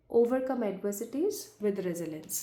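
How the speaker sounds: slow, soft affirmation read. Acted. Tarot reader to viewers, slow soft affirmation. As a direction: read each affirmation slowly and softly.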